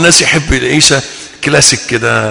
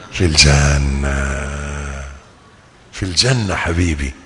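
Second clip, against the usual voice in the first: tender and soft; 12 semitones lower, 9 dB quieter, slower than usual. Acted. Tender promise of paradise.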